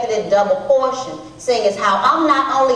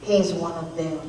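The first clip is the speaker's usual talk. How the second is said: low, hushed private judgement; thinking to herself; 8 semitones lower, 9 dB quieter than usual. Acted. Woman sizing up the stranger at the well, thinking to herself. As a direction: mutter it low, as a thought kept to yourself. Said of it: "thought to myself".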